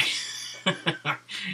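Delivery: breathy, voiceless laughter; amused laugh. Real, not acted. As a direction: laugh softly at the absurdity. Laughs at the absurd vote.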